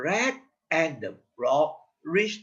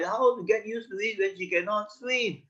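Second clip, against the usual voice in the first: usual speaking voice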